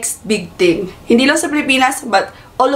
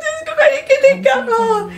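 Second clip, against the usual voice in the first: squealing; 12 semitones higher than usual. Real, not acted. Gushing over idols in video.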